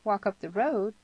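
put-on mildly baffled girl's question; bewildered. A narrator's reading. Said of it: "she repeated, bewildered".

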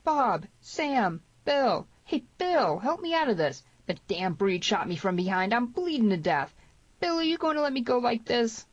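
high, urgent cries for help; frantic appeal. A narrator's reading. Captive Tierney begs familiar faces for rescue, frantic appeal. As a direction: call out desperately to friends, pitched high. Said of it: "began to make a frantic appeal".

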